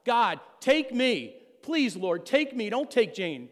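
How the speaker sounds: slow, loud pleading cry, pitch up high; crying out to God. Retold or imitated. Husband on the hope chest begs God to take him, loud desperate cry. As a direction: beg God with everything you have. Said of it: "crying out to God".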